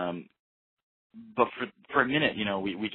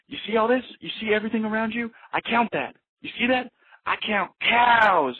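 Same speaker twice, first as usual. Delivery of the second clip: much higher, loud, manic imitation of an odd local; eccentric Dave impression; 13 semitones higher, 6 dB louder than usual.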